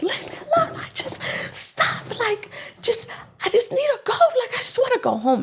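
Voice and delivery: whispered, near-tears plea turning to shaky laughing sobs; retold breakdown, whispered with crying laughs; breaking down. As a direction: whisper it like you're falling apart. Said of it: "And I start, like, crying".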